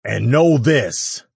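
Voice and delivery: slow, loud, high warning; stern warning. A narrator's reading. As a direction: drag it out as a threat. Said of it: "Ash continued".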